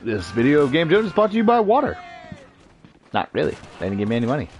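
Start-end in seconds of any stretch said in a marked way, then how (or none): 0.0-2.0 high, rising announcer parody; mock sponsor read. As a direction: hype a fake sponsor, climbing in pitch. Mock sponsor announcement, then "Not really.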